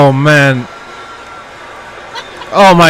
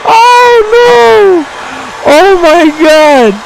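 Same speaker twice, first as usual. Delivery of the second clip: much higher, rising to a laughing scream; hyped shouting; 11 semitones higher than usual. Real, not acted. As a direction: lose it completely over the Stunner. Wild reaction to the Stunner.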